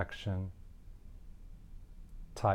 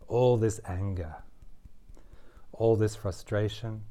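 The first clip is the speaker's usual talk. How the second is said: mildly heated, deliberate read of anger statements; tapping script. Acted. Tapping coach voicing the client's anger for them to repeat, mildly heated. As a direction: voice the anger lightly as you tap, with a touch of heat.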